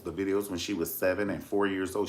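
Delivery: ordinary delivery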